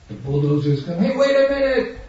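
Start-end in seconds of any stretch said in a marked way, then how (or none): 1.0-2.0 panicked tenant. Retold or imitated. Evicted tenant facing bulldozers.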